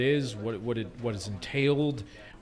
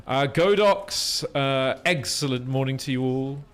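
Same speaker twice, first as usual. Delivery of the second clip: ordinary talk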